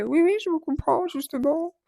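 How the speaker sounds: high, eager yes yes; imitating the director. Vlogger imitates the crèche director agreeing, voice pushed high. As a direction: play the director agreeing too quickly. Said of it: "Et elle me dit".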